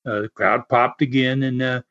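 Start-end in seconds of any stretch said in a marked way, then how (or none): none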